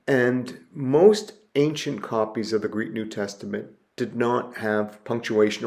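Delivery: usual speaking voice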